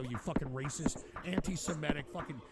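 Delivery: ordinary talk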